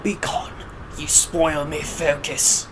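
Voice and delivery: soft, mock-knight echo; mimicking game knight. Player echoing the knight's dismissal, mimicking softly. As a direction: quietly parrot the knight's dismissal.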